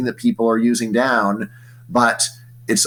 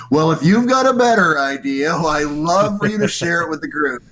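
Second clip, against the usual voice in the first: a higher, mocking comeback voice; sarcastic comeback; 6 semitones higher than usual. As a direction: throw it back at a complainer, teasing. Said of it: "there's a classic comeback".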